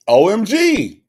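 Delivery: high, loud, emphatic OMG; loud high-pitched enthusiasm; excited OMG. Real, not acted. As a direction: burst out raving, letter by letter. Raving about Costco muffins.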